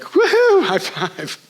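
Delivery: a loud laughing whoop of celebration, then a laughing high five; whooping and laughing together; whooping cheer. Retold or imitated. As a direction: cheer like you just won. Celebrating after the officer leaves.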